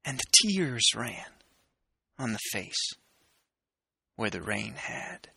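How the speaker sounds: dramatic poetry recitation with long pauses; poem reading. Acted.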